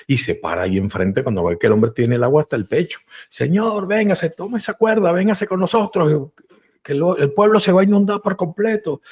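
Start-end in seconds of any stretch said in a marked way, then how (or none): 3.3-9.0 high urgent call, much higher; calling rescuer. Acted. Boat crew calls to the man, high and urgent. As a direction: plead with him to grab the rope.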